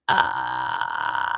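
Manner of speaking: low creaky fry on ah; vocal fry. Shown as a demonstration. Vocal coach warming up class with creaky fry. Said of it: "give me a little bit of vocal fry".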